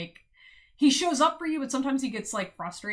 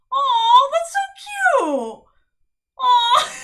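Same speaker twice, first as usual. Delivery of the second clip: very high, loud drawn-out squeal of delight; gushing; 13 dB louder, much slower than usual. Real, not acted. Reader melting at a partner's sweet message, loud high gushing. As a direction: gush over something adorable, stretching it out.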